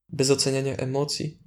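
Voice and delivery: usual speaking voice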